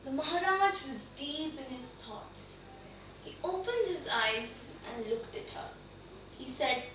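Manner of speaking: soft, low storytelling; hushed narration. A narrator's reading. Narrator shows the Maharaj deep in thought, hushed. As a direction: drop softly into a pensive hush.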